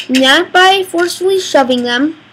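normal talking voice

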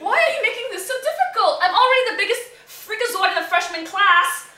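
high, loud, laughing frustration; exasperated. Acted. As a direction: whine at the toy in exasperation. Exasperated teen to toy.

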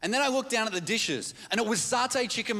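normal talking voice

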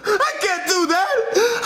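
slow, high blubbering whine; crying protest. Acted. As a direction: wail it through hammed-up sobs. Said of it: "stop crying".

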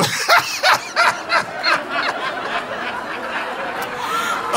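long high wheezing cackle; helpless laughter. Real, not acted. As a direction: laugh until you run out of air. Cracks up at the correction.

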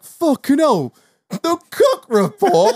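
high, slow astonished dad; mock amazed father; dad's surprise. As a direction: gasp at the memory. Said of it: "my dad was like".

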